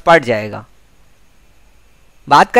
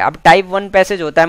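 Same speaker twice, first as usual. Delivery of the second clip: normal talking voice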